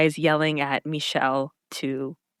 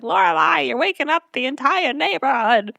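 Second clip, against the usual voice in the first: Babette; 8 semitones higher than usual. Retold or imitated. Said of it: "Babette comes out of her window being like".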